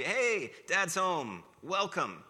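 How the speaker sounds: high, loud, slow call across the house; hammed-up father announcing himself at the door. Father calls to an empty house, loud and drawn out.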